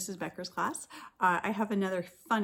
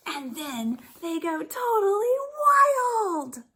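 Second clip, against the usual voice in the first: much higher, much louder, slow and cried out; wild outburst; 9 semitones higher, 9 dB louder than usual. Acted. Teacher exploding the story climax for her class. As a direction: let it burst out wild.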